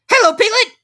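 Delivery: high little squeak; tiny excited squeaky voice; squeaky Roo. A narrator's reading. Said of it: "he squeaked".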